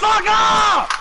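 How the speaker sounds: high, drawn-out angry shout. Real, not acted. Player to rivals in a heated match, angry high shout. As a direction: shout them away, pitched high.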